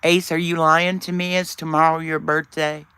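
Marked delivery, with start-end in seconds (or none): none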